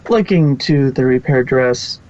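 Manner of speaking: plain reading voice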